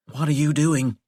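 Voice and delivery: ordinary delivery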